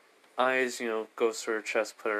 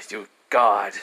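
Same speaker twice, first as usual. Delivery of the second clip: louder, fed-up outburst; exasperated shout; 7 dB louder than usual. Retold or imitated. Hermes spotting Belle up the tree, louder exasperated cry. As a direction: bark it at the tree. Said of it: "he was like".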